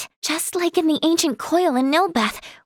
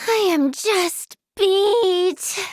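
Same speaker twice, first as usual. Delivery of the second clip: very slow, stretched-out complaint; exhausted whine; 3 semitones higher, much slower than usual. Acted. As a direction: drag every word out wearily. Declares exhaustion, then takes it back.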